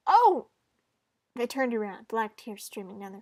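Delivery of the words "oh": loud, high "Oh!" of shock; sharp startled exclamation. Acted. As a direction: jump with a sudden yelp. Startled by the crying face.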